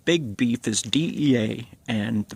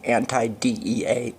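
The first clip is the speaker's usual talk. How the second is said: usual speaking voice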